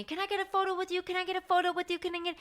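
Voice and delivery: high, quick, over-eager fan voices. Retold or imitated. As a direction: pile on like a crowd begging for selfies. Crowd rushing for photos.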